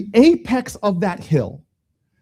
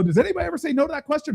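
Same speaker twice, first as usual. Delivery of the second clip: quick, high-pitched teasing question; 8 semitones higher, much faster than usual. Retold or imitated. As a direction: rattle it off to tease her. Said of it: "And I teased her".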